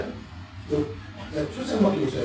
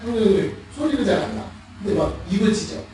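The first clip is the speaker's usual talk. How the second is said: ordinary talk, nothing marked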